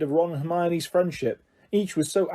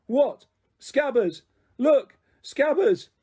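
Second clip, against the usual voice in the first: panicked shouting; 8 semitones higher, much slower than usual. Acted. Panicked shouting over the rat.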